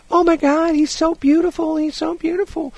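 very high, gushing imitation; mock fawning in a squeaky-high voice; over-fawning. Bank women cooing over a customer's parrot, over-fawning. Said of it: "over fawning over this parrot".

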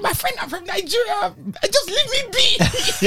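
pleading with the boys in a fake Nigerian accent; hammed-up Nigerian accent. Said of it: "trying to put on Nigerian accent".

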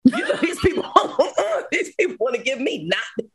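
laughing through the words, pitch jumping high. Real, not acted. Telling the host about her cell, laughing hard. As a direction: crack up while telling it.

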